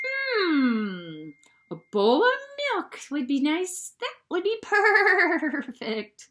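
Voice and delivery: high, slow purring cat voice with a giggle; dreamy cat. A narrator's reading. The cat dreaming of milk, high with a little laugh. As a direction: savour it like a cat imagining milk. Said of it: "said the cat".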